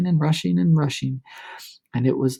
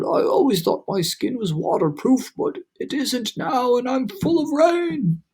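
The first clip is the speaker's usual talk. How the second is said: grumbling earthworm; 7 semitones higher than usual. A narrator's reading. Earthworm complaining, soaked.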